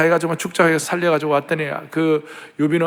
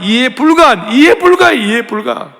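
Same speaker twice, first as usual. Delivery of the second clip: rising fervour; 4 semitones higher, 5 dB louder than usual. Acted. Preacher building on God's love.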